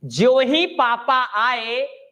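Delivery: ज्यों ही पापा आए drawn out slowly, each word spaced; slow, deliberate teacher model; slow model sentence. Shown as a demonstration. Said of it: "ऐसे बोलते हैं।".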